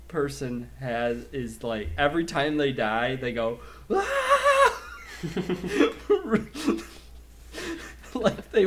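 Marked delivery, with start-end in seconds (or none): 3.9-4.8 very high, loud hammed-up scream; mock death scream. Imitating enemies dying in the game, over-the-top death scream. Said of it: "the death screaming".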